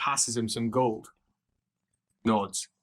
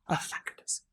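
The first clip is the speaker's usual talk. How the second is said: breathy whispered relief; relieved whisper; 8 dB quieter, faster than usual. Acted. Lucas, relieved to drop his bags, whispering. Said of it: "Lucas goes".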